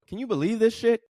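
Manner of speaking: high-pitched, incredulous aside; mock disbelief. Turning to a romantic partner to share disbelief, incredulous. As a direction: squeal it in playful disbelief to a confidant.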